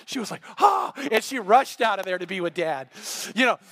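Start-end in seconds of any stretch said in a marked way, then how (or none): 0.6-0.9 high, loud mock gasp; imitated gasp. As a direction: gasp out a startled "Ah". Imitates her reaction before rushing out.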